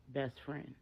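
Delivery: ordinary delivery, nothing marked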